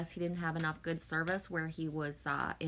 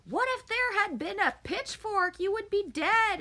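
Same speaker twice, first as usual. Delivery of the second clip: high, loud mock rant of a livid father; imitating angry dad; 12 semitones higher, 4 dB louder than usual. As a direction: throw the dad's fury back at him, pitched up. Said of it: "he was fucking livid".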